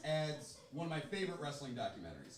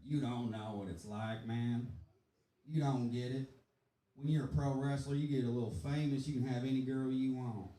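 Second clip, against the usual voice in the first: ordinary delivery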